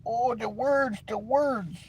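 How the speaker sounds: high, soft whimpering mock lament; mocking the easily offended, high and soft; whiny victim. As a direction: whine like words wound you. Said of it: "make 'em gotta go".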